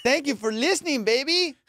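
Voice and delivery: put-on thanking Alexa like a girlfriend, high and gushing; sweet-talking lover. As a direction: gush over her like a boyfriend. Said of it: "I'm like".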